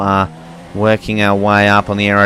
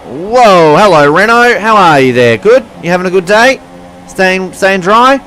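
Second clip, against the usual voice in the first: mock-friendly sarcasm; 10 semitones higher, 8 dB louder than usual. Real, not acted. Sarcastic greeting to rival car.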